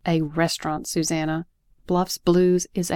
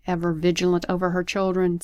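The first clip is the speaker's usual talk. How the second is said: ordinary delivery, nothing marked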